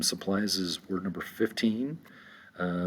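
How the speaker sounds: ordinary talk, nothing marked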